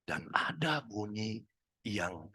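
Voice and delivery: breathy whisper, slow. Shown as a demonstration. Speaker demonstrates the kinesthetic whisper voice, slow. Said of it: "isinya adalah kinestetik".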